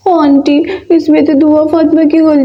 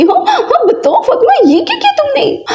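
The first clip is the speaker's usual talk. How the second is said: high, teary half-laughing wail; 10 semitones higher than usual. Acted. Mother wails over wasted food. (ऊँची, रोती-हँसती चीख़)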